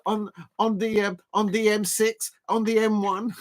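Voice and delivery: put-on Liverpool accent. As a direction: grumble in a mock Liverpool accent. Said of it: "the Liverpool voice of me is coming".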